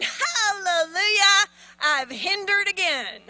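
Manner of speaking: loud, drawn-out hallelujah of triumph; hindering spirit rejoicing. Acted. Spirits celebrating a hindered believer, loud drawn-out rejoicing. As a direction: crow it slowly and loudly, celebrating. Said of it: "And they're going".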